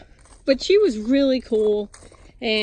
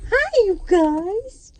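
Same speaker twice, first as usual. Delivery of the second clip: high, slow sing-song greeting to pets; 11 semitones higher, 5 dB louder, much slower than usual. Real, not acted. Woman cooing hello to her chickens, high and drawn out.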